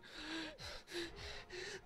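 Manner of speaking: high, breathy gasp of being moved; tearful high gasp. Retold or imitated. Player moved by Olivia's words, high tearful gasp. Touched by friend line.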